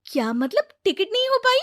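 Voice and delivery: high, loud disbelief; shocked question. Acted. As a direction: question him in alarm. दीदी बुरी खबर से चौंकी. (चौंककर ऊँची आवाज़ में सवाल)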